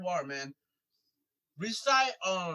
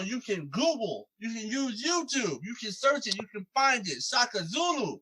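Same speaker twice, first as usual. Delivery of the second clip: punchy hype-man intro with swooping pitch, each phrase falling at the end; podcast intro announcer; 2 semitones higher, 6 dB louder than usual. Acted. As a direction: announce the guest like a ring announcer, building louder to the name. Hosts the show's announced intro.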